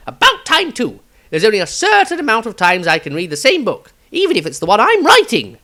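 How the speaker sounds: Maestro's eccentric wizard voice. Acted. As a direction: chatter like a dotty, excitable wizard. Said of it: "Extract from the Diary of Maestro".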